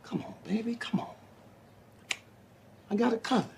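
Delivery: hushed, intimate coaxing; soft coaxing. Acted. Husband coaxing his upset wife, hushed and tender. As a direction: drop to a near-whisper and coax gently.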